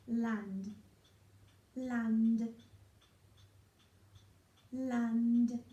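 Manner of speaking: "land" said slowly, sound by sound, loud. Shown as a demonstration. Phonics teacher sounding out a word for children, slow and loud. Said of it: "sound out the first part".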